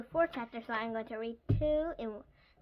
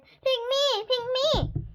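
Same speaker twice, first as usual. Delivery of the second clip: put-on high, loud kids' clamour; excited shouting; 13 semitones higher, 10 dB louder, slower than usual. A narrator's reading. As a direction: shout it like pupils begging to be picked. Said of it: "the kids all shouted".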